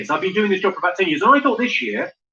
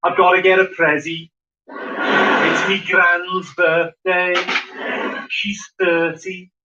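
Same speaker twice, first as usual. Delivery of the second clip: authentically Scouse. Shown as a demonstration. Comedian shows off something properly Scouse, in accent. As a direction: put on a mock Scouse accent. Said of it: "authentically Scouse".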